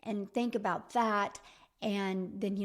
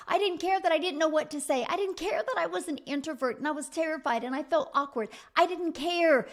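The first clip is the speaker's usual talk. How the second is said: building defiance; 5 semitones higher, 5 dB louder than usual. Acted.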